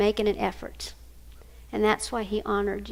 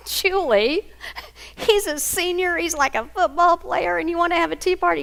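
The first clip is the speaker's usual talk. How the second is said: high-pitched retold disbelief; incredulous mother; 7 semitones higher, 3 dB louder than usual. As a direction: question it in pitched-up, mock astonishment. Mother incredulous at daughter's idea.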